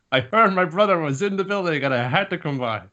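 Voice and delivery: high, showy grand entrance; flamboyant brother. Retold or imitated. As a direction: sweep in like a theatrical big brother.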